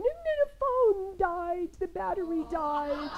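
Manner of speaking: put-on high, crying little girl; toddler voice. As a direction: imitate a three-year-old wailing. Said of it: "and she went".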